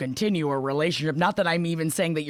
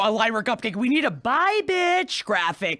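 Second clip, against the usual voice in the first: ordinary delivery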